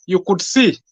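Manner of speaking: normal talking voice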